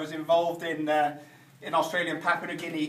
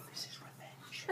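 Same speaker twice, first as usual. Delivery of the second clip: whisper; 24 dB quieter than usual. Real, not acted. Whispered aside about revenge.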